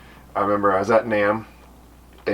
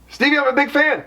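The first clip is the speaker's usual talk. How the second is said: very high, delighted greeting ending in a laugh. Retold or imitated. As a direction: greet him high and warmly, then laugh. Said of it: "he's just like".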